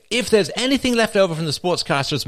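"if" hit hard and high; stress on IF. Acted. Cocaine producers putting sportscasters first, heavy stress on "if". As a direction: lean heavily on the word "if". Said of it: "I emphasize the word if".

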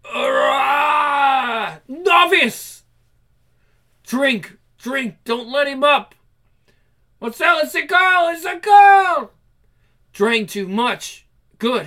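high, loud frantic cries; hammed-up comic vampire shouting; frantic vampires fighting. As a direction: scream as the heart gives out.

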